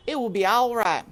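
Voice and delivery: high, loud scornful housekeeper; grim maid Louise. A narrator's reading. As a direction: snap it like a tough housekeeper. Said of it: "said Louise".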